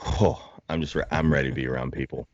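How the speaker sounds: usual speaking voice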